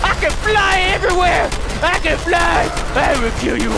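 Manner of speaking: high, loud gloating yell; triumphant shouting. Real, not acted. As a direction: crow over the enemy. Triumphant taunt while flying.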